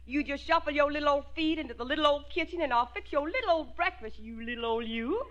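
sugary mock-Southern belle; hammed-up Southern lilt; heavy Southern accent. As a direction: humour him with an overdone Southern accent. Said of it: "give him that Southern accent as heavy as we can".

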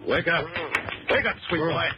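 high, mocking wake-up call; rousing call. Gunman waking his groggy captive, mock-sweet rousing call.

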